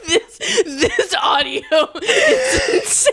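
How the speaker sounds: talking through laughter; words breaking with laughs; laughing. Real, not acted. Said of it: "You're wheezing".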